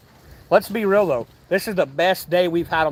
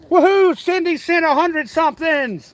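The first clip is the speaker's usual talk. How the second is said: excited cheer; 8 semitones higher than usual. Real, not acted. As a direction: cheer over the surprise gift. Thanking a viewer for gifts.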